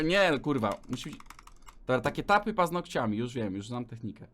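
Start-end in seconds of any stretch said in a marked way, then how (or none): none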